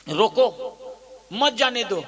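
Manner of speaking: रोको on its own, pause, then मत जाने दो together; pause after रोको. Shown as a demonstration. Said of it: "अब अल्प विराम ला मत में लेक लगा देता हूँ".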